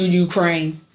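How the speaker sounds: ordinary talk, nothing marked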